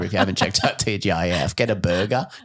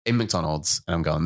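ordinary talk, nothing marked

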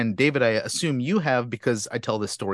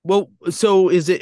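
normal talking voice

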